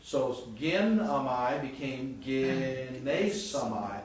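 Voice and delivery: each syllable drawn out slowly; slow, careful pronunciation demo. Shown as a demonstration. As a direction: spell the forms out slowly for students. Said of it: "because it's easier to say gene samai".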